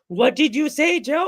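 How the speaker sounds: high-pitched mock challenge; cartoon girlfriend. Girlfriend confronting Joe in a cartoon, mock offended. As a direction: pipe up as an offended cartoon woman.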